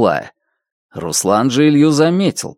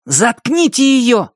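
commanding; 11 semitones higher than usual. Acted. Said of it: "распорядился Руслан".

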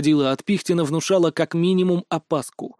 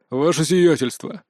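slow, glum address to a superior; gloomy mutter. A narrator's reading. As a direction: mutter it gloomily, dragging the words. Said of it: "хмуро пробормотал Богдан".

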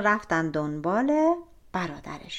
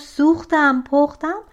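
calling out; 5 semitones higher, 7 dB louder than usual. A narrator's reading. Malek Mohammad calls from the well.

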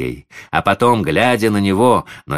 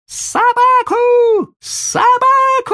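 very high, loud, stretched squeal; boy's wailing scream; 8 dB louder, much slower than usual. A narrator's reading. Spoiled boy screaming for the dog, wailing. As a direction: wail the word out like a screaming child. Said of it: "залился громче прежнего мальчик".

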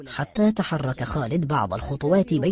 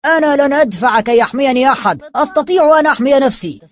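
loud defiant shout, higher; defiant, louder cry; 10 semitones higher, 10 dB louder than usual. A narrator's reading. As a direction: yell it out in defiance. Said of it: "فصاح يامن".

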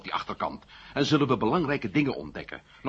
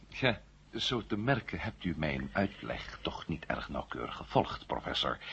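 hushed, breathy undertone; muttered aside; 5 semitones lower, 6 dB quieter than usual. Acted. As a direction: half-whisper a dry complaint. Aside while pretending to agree.

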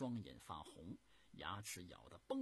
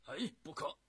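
loud, high, abrupt veto; urgent objection; 9 semitones higher, 10 dB louder than usual. A narrator's reading. Emperor halting his general's charge, urgent loud objection. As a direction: cut in forcefully to stop them.

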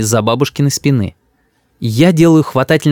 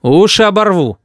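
higher-pitched parental threat; threatening; 7 semitones higher than usual. A narrator's reading. Father threatens his cheeky son, menacing. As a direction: warn the boy with menace. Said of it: "угрожающе говорю я".

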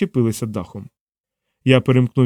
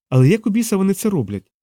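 high-pitched, exasperated question; worked-up demand for answers; agitated; 8 semitones higher than usual. A narrator's reading. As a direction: keep pressing, voice raised in pitch. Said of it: "не вгамовувалась Фіона".

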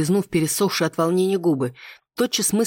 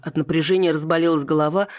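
usual speaking voice

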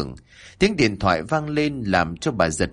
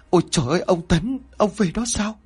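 narrated scream, raised high in pitch; startled cry; 10 semitones higher, faster than usual. A narrator's reading. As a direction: cry out as if seeing a ghost. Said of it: "bà há mồm mà hét lớn".

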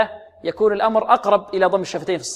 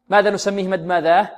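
usual speaking voice

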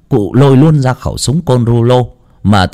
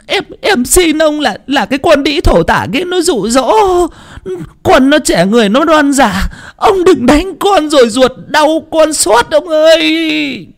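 put-on much higher, sobbing old mother begging; tearful pleading. A narrator's reading. Mother begging her husband to spare their son, tearful pleading. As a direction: beg through tears, voice pitched up high. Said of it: "miệng mếu máo".

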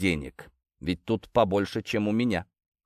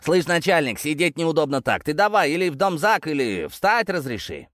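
insolent shout; 6 semitones higher than usual. Acted. Detainee calling out to the police chief, insolent shout. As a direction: mouth off at the boss. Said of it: "нагло крикнул".

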